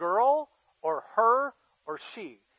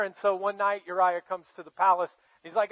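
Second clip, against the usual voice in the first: usual speaking voice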